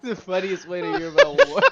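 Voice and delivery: said through laughter; laughing. Real, not acted. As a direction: laugh while saying it. Laughing at GM joke.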